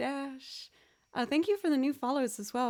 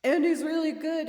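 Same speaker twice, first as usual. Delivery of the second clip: louder, higher quick shout; yelled shout-out; 6 semitones higher, 7 dB louder than usual. Shown as a demonstration. Quick shout-out for Andy to chat, louder half-yell. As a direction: blurt the shout-out loud and fast. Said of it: "maybe yell it a little bit".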